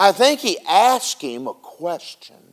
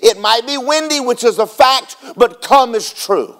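rising, louder preaching cadence; 6 semitones higher, 3 dB louder than usual. Acted. Pastor driving the point home to the congregation, preaching. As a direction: preach it with full fire.

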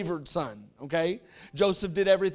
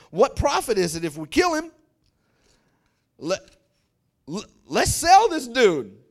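louder, bold brother pitching a plan; Judah scheming; 3 semitones higher, 7 dB louder than usual. Retold or imitated. Judah urging his brothers, louder and bold. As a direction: sell the idea to the brothers. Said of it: "So Judah said to his brothers".

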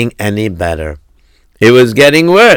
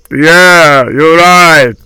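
higher, louder put-on crony voice; agreeing gabbai; 5 semitones higher, 5 dB louder, much slower than usual. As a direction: agree emphatically as a second old gabbai.

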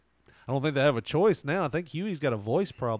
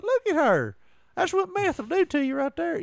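much higher mocking gossip voice; gossipy simpleton. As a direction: sneer like a judgy gossip. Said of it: "simpletons out there, they're like".

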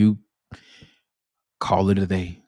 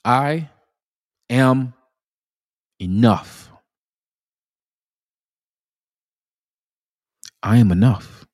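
energetic affirmation; 3 semitones higher than usual. Shown as a demonstration. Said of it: "use a little energy now".

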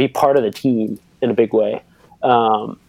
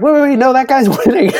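much higher, louder mock fans, laughing; high squeaky realization, breaking into a laugh; crowd impression laughing; 14 semitones higher, 5 dB louder than usual. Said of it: "They're like".

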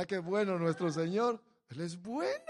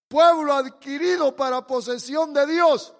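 proclaimed loudly; 8 semitones higher, 17 dB louder than usual. Shown as a demonstration. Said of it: "mire lo que dice".

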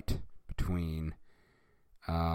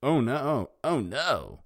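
raised, louder cartoon cry of alarm; high theatrical dismay; mock alarmed shout; 6 semitones higher, 4 dB louder, slower than usual. A narrator's reading. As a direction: cry out in hammed-up panic. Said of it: "and he shouted".

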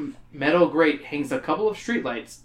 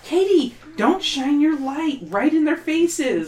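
scolding mom; 11 semitones higher, slower than usual. Acted. Mother scolds daughter.

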